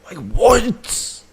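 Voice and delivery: can't believe what he heard; incredulous. Real, not acted. Streamer reacting in disbelief.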